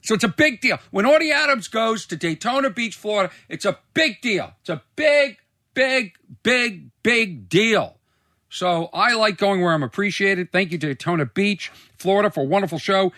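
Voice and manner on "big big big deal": each BIG punched separately, high-pitched; emphatic repetition. Real, not acted. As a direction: hammer every "big" with a pause after it. Hammed-up boast about his own fame.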